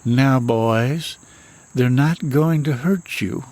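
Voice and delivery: a hint of a polite Southern drawl, slow. Mother calmly tells her boys about the ghosts, a touch of Southern drawl. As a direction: lightly voice a soothing Southern mother. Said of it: "in her polite little Southern drawl".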